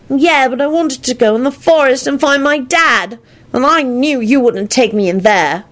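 put-on a kid grumbling about a pest; high child voice, annoyed. Teddy voice.

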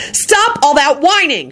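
high, stretched-out scolding; yelled order. A narrator's reading. Woman yelling at whining quadruplets. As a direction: yell it slowly, pitched high. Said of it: "Shyla yelled".

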